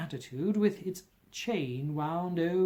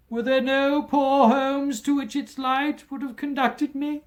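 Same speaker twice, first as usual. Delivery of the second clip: much higher and louder despairing question; anguished cry; 10 semitones higher, 9 dB louder than usual. A narrator's reading. Ghost crying out its regret to Scrooge, high and loud. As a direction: wail it up to the heavens.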